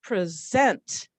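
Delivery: "present" with the second syllable punched; pre-SENT, slight first syllable, strong second; stress on SENT. Shown as a demonstration. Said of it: "I'm punching this one".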